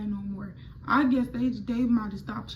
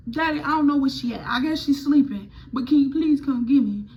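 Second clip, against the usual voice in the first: pleading; 3 semitones higher, 6 dB louder than usual. Retold or imitated. Pleading with dad.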